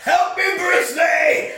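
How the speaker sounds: high, slow, hammed-up cry for help; mock desperate shout. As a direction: yell to Bruce Lee in mock despair. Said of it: "I need your help".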